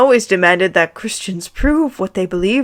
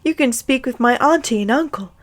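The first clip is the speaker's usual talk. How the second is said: polite; 5 semitones higher than usual. Acted. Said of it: "Harry retorted politely".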